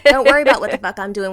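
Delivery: loud, fast snapping retort; mock rant. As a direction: fire it back at the judging stranger. Ranting at imagined judging stranger.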